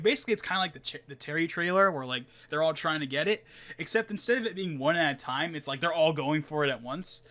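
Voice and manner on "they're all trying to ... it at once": ordinary delivery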